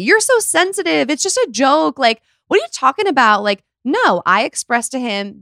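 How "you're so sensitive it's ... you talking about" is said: high, dismissive retort imitating a partner; mock gaslighting. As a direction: brush off the complaint, acted out high. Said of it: "like, gaslighting me".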